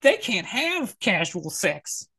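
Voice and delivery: high-pitched mock argument, stretched out at the end; mocking caricature. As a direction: parody his claim in a put-on voice. Parodies the clip's argument.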